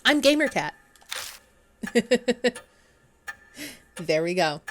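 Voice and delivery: normal talking voice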